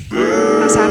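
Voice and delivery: high, loud mock wail of how sad. Vlogger mocking the melted snow, high loud mock wail. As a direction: wail it like a fake tragedy.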